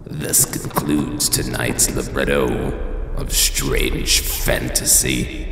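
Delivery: ordinary talk, nothing marked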